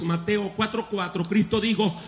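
ordinary delivery

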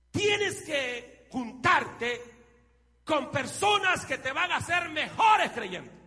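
slow, high, loud preacher's cry; roaring sermon climax. Acted. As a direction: drive the warning home with full voice. Climax over music. (clímax de predicación gritado)

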